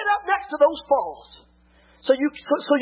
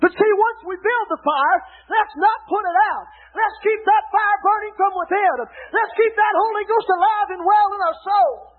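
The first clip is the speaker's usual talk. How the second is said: preaching at full force; 4 semitones higher than usual. Acted. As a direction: drive it home to the pews. Preaching keep fire burning.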